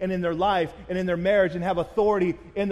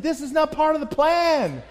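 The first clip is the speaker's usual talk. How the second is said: higher, louder, alarmed; panicked husband; 8 semitones higher, 5 dB louder than usual. Retold or imitated. Husband speeding to hospital, alarmed and loud. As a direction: blurt it out in rising panic.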